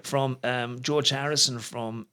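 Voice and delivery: usual speaking voice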